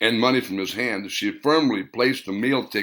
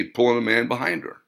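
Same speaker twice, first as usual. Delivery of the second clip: ordinary delivery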